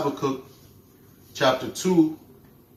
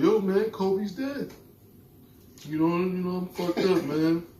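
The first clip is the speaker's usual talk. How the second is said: higher, slow, mock mournful; mock grieving dude; 4 semitones higher, 3 dB quieter, slower than usual. Mimicking the sad fan on the street, mock mournful. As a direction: ham up a grieving fan, dragging the words. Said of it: "He's like".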